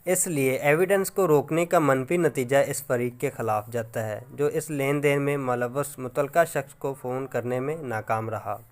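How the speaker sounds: ordinary delivery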